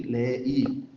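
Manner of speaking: LE, I as two separate syllables; accented final i. Shown as a demonstration. Teacher repeating the tilde example, LE, I in two syllables. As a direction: keep the i of LE, I its own stressed syllable.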